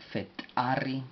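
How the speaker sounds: Fetari with a popped T before the a; careful teacher's demo of Fetari; explosive T. Shown as a demonstration. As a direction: split Fetari after Fe, then pop the T. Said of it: "T explosiva A".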